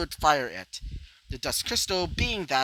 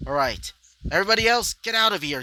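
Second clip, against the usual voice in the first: higher, louder and fast order to clear out; urgent call; 5 semitones higher, 5 dB louder, faster than usual. Acted. Hero telling everyone to get out, fast urgent shout. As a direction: hurry everyone away with a quick shout.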